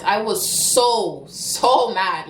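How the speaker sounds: a long, weighted "so" before repeating it; drawn-out emphasis. Real, not acted. Woman telling viewers about being robbed, drawn-out emphasis on "so". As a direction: stretch "so" out slowly, then "so mad". Dwells on how angry she was.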